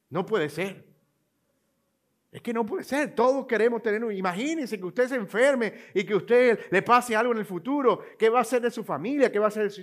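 high, loud, fast, exalted sales pitch; hammed-up thrilled saleswoman insisting; overexcited saleswoman. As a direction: imitate a telemarketer who can't believe you'd say no. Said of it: "exaltada, emocionadísima".

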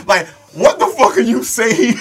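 high, very loud, drawn-out disbelief; worked-up, high-pitched yell; incredulous outburst. Real, not acted. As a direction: shout it slowly in real amazement. Hyped reaction to retold line.

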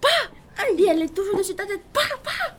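high, loud frightened cries; panicked shriek. Acted. Spider on head, panic.

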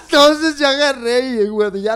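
loud laughing talk. Real, not acted. Telling the friend about the injury, laughing loudly. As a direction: laugh through the words.

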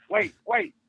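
panicked pleading. Retold or imitated. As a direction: squeak it out as if the mind went blank. Retold panic when forgetting the technique.

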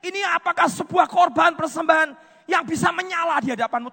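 loud, high cry to God, retold from the pulpit; retold tearful plea. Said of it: "Saya naruh sambil nangis berkata". (menirukan doa sambil menangis, bersuara lantang)

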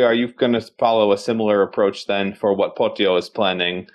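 ordinary talk, nothing marked

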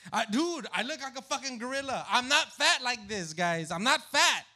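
high, loud, indignant outburst; loud protest. Real, not acted. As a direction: protest at the top of your voice. Protesting how he looks.

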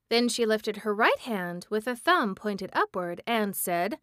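ordinary delivery, nothing marked

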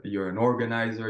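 usual speaking voice

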